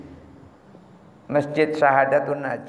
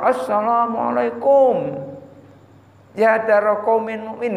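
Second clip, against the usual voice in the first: mock shouting; 5 semitones higher than usual. Shown as a demonstration. Preacher imitating a needless shout to the graveyard's dead, mock shouting. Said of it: "nggak usah berteriak-teriak".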